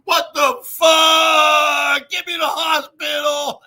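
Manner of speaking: loud, high, drawn-out shout; mock panicked yell. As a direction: yell it like a man dangling from an ambulance. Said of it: "It's like".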